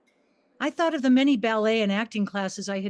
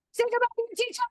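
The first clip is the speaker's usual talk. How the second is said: "say goodbye to your teacher" held on one much higher pitch; sustained inflection; 12 semitones higher, much faster than usual. Retold or imitated. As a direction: call it out on a held high note. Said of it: "sustained inflection and alteration in volume".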